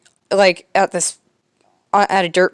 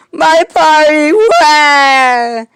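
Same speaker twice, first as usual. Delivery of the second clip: high, loud, slow fake wailing; hammed-up cartoon crying, much higher and louder; mock crying; 8 semitones higher, 8 dB louder, much slower than usual. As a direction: wail it like a bawling toddler. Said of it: "Jeff is crying".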